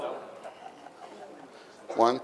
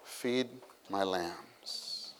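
soft, slow, tender; the Lord's soft, slow command; tender quote; 6 semitones lower, 18 dB quieter, much slower than usual. Retold or imitated. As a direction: say it like a gentle charge. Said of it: "Actually, it's".

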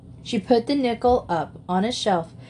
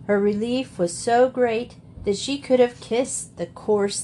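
ordinary delivery, nothing marked

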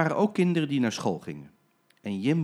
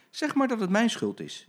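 much higher, light reassuring; breezy driver; 11 semitones higher, 4 dB louder than usual. Acted. Jim reassuring the children, breezy. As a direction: take the blame cheerfully. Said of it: "zei Jim".

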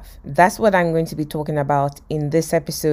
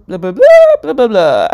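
loud, high-pitched mock babble dropping at the end; gibberish attempt; 4 semitones higher, 11 dB louder than usual. Joey failing to copy Phoebe's French, loud gibberish. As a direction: babble it confidently, starting way up high. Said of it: "basically gibberish".

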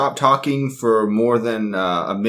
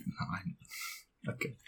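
whispering; 20 dB quieter, much slower than usual. Real, not acted. Trailing off in defeat.

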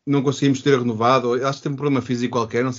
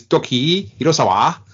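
slow name in a mock Japanese accent; Japanese accent Portuguese; 2 semitones higher, 3 dB louder, slower than usual. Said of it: "com o meu sotaque japonês".

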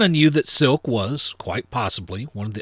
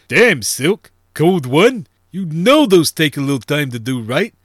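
louder, higher-pitched protest; complaining; 4 semitones higher, 6 dB louder than usual. A narrator's reading. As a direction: balk at a costly rush job. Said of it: "he complained".

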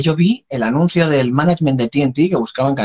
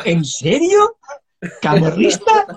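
high-pitched mock disbelief, questioning; incredulous; 8 semitones higher, slower than usual. Singer replays his reaction to the manager's choice, incredulous. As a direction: act out a high, incredulous double take.

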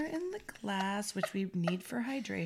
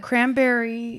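loud proclamation; 4 semitones higher, 9 dB louder, slower than usual. Real, not acted. Toast-like proclamation.

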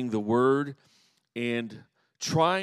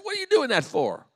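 put-on a mild teen scold, pitched higher; 13 semitones higher, 4 dB louder than usual. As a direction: tell your cousin off, half-joking. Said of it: "I'd kinda scold her".

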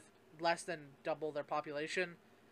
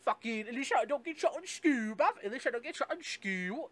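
mock British accent, higher and louder; 6 semitones higher, 5 dB louder than usual. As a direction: sneer it like a cheeky Brit in a British accent. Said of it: "this buck-toothed British person".

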